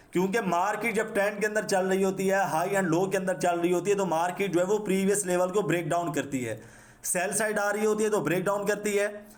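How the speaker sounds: normal talking voice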